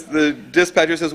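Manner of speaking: normal talking voice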